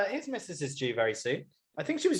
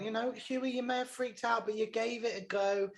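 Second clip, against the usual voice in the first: higher, softer pep-talk voice; Nanny; 6 semitones higher, 5 dB quieter than usual. Retold or imitated. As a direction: gently voice a reassuring pep talk, pitched up. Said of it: "then Nanny was like".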